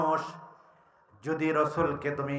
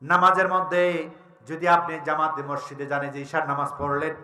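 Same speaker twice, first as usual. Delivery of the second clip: ordinary talk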